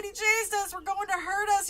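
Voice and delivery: put-on high, loud child's protest; crying out. A narrator's reading. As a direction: cry out like an alarmed kid. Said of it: "I cried".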